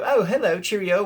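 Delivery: cheery mock-British greeting; bad English accent. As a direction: put on a hammed-up, bad UK accent. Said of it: "that was a horrible English, uh, uh, uh, U K accent".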